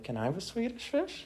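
put-on high, soft little-kid voice; imitated little brother. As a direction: mimic a small child asking for a treat. Imitates little brother asking for candy.

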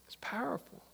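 near-whispered, letting it sink in; hushed. Real, not acted. Preacher lets the point sink in, hushed. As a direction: drop to an awed whisper.

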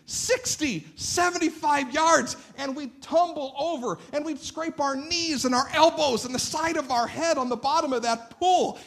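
high, building excitement; excited storytelling. Retold or imitated. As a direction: ride the wave of the story, breathless. Excited retelling of wave pool.

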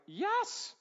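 high, loud and tender, the last words slow; a parent's joyful, tender I forgive you; warm forgiveness. Shown as a demonstration. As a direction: beam out forgiveness to a sorry child. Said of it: "I can immediately at this point be like".

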